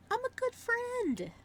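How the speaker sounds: squeaky high, slow and smug; high mock voice. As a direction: say it in a silly high voice. Mock sweet self-praise.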